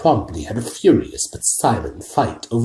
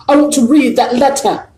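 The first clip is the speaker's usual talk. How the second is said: much higher, louder demand; loudly; 8 dB louder than usual. A narrator's reading. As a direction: spoilt boy demanding. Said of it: "he said loudly".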